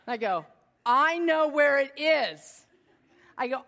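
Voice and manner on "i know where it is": confident boast. Retold or imitated. Boasting to husband.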